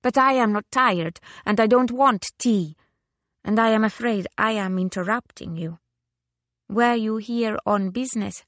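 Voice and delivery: insistent Italian lady, voice lifted high; Italian widow. Acted. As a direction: protest politely in an Italian accent. Italian widow protests, urges guest on.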